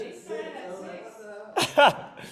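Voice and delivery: high amused laugh; real laugh. Real, not acted. Coach teasing about hated seal walks, laughing.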